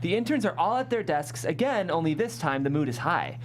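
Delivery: ordinary talk, nothing marked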